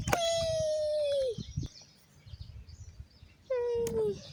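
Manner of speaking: gleeful high whee. Pretend. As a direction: squeal down the slide. Doll sliding.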